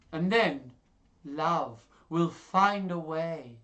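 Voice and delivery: slow out the ending like a dreamy optimist; hammed-up lovestruck hope; hopeful lover. Smitten character declares his hope.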